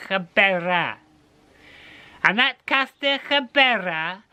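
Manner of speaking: put-on high old woman giving directions; old lady. Old lady telling the cat the road to Barmouth, high. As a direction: lay out the journey like a wise old crone.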